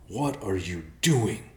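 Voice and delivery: slow, drawn-out disbelief; stunned bear, half-hushed reproach; shocked. A narrator's reading. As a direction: ask it aghast, stretching each word.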